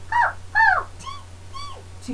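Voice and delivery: very high squeaky mouse giggle. Shown as a demonstration. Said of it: "our mouse voice".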